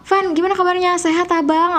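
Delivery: high gentle greeting; a high, soft hello to a friend; soft Sundanese greeting. Shown as a demonstration. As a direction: greet him sweetly and lightly. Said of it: "tetep kalau ketemu sama orang".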